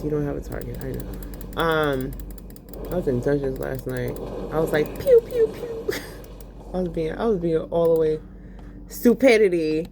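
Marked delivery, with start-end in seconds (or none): 5.0-6.0 high, slow mock laser sounds; playful high pew pew sound effects; pew pew noises. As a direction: squeak out toy blaster sounds. Gun noises in dungeon.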